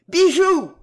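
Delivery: loud, high call of the name; shouted call. A narrator's reading. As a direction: cry out to interrupt them. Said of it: "cria Pierrot qui les sépara". (cri aigu et sonore)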